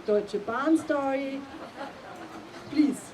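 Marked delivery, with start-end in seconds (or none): none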